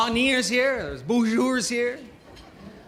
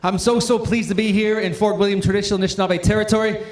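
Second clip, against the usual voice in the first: ordinary talk, nothing marked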